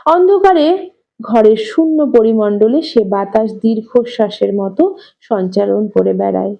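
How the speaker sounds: usual speaking voice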